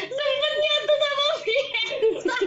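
laughing. Real, not acted. Uncontrollable group laughter.

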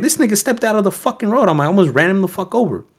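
high-pitched, animated retelling; retold protest. Driver telling police about the man in the road, high-pitched protest. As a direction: relay your protest with the pitch jumping up.

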